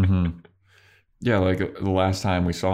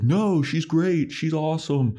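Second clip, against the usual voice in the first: mock gushing defense; 9 semitones higher than usual. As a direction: imitate a naive admirer protesting in a high voice. Mocking Riley's devotion to Walsh.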